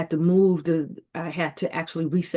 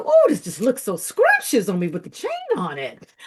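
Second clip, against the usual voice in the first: delighted; 5 semitones higher, 5 dB louder than usual. Retold or imitated. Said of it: "I said".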